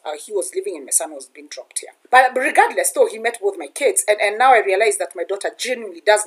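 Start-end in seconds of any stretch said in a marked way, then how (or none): none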